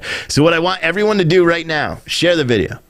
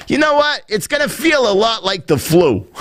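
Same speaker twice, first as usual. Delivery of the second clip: higher-pitched mock New York doctor; New York accent; 8 semitones higher than usual. Doctor describing mild symptoms, New York accent. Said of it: "South African person a New York accent".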